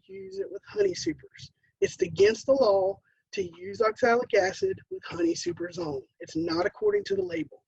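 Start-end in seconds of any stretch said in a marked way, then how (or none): none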